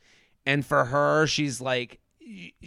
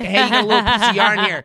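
playful mock remark; 3 semitones higher, 4 dB louder, faster than usual. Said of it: "So, like".

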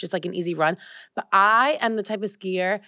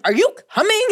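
high, loud impatient call; 12 semitones higher, 5 dB louder than usual. Retold or imitated. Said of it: "And I'm like".

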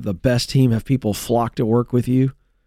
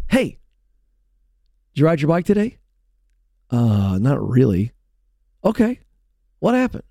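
cheery dad; 2 semitones higher than usual. Retold or imitated. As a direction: chirp the questions like a breezy dad. Dad asking kid again.

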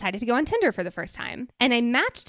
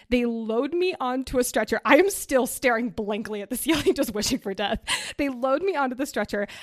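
usual speaking voice

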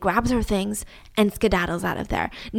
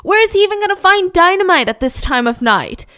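scoffing dad; 8 semitones higher, 6 dB louder than usual. Acted.

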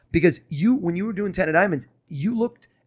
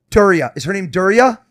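loud, a touch higher; proud guess, louder; excited reveal; 5 semitones higher, 11 dB louder than usual. Real, not acted. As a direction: land the name like a magic trick.

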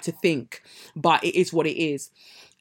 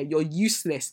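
usual speaking voice

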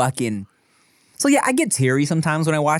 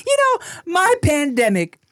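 mock doting neighbor; 9 semitones higher than usual. Playing the neighbor, laughing.

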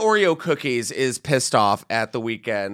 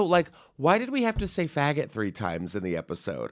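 ordinary talk, nothing marked

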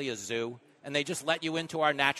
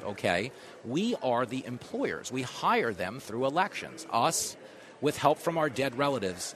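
usual speaking voice

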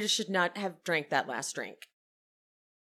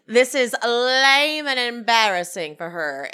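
loud, drawn-out haughty scolding; Meredith; 5 dB louder than usual. Retold or imitated. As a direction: stretch LAME with theatrical disdain. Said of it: "Meredith says".

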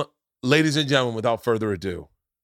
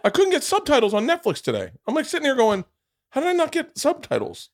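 exasperated. Real, not acted. Exasperated rant about subtitles.

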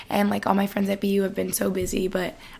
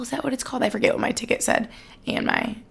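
usual speaking voice

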